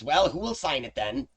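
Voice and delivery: high imp voice; impatient imp. Acted. Game master's imp asks who will sign, impatient. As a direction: demand a signer as the imp.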